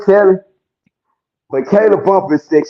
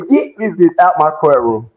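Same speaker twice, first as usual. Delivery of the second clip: ordinary delivery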